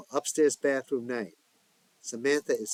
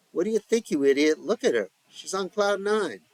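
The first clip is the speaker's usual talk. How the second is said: scornful retort; 8 semitones higher, 7 dB louder than usual. A narrator's reading. Joey snaps at Kamala.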